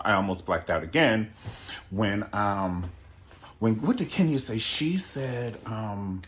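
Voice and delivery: usual speaking voice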